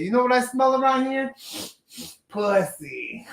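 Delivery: retelling the line in a put-on East Coast accent. Explaining the House Party quote to his co-host, in an East Coast accent. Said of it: "he has this East Coast accent saying".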